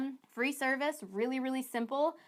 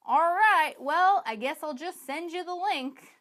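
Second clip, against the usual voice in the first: higher, louder, drawn-out "all right, well"; mock awkward hedging; 5 semitones higher, 6 dB louder, slower than usual. Coach voices a seller sending the link, drawn-out awkward hedging. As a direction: imitate someone stalling, then giving in slowly. Acts out a weak, awkward close.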